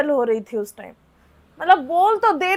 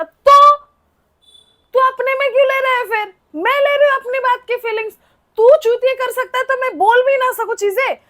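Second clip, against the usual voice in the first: raised, heated comeback, pitch up high; heated retort; 7 semitones higher, 4 dB louder than usual. Retold or imitated. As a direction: snap back at him, louder and higher. (गुस्से में ऊँची आवाज़ में जवाब)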